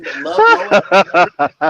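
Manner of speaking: drawn-out announcement. Real, not acted. Host announcing arriving guest.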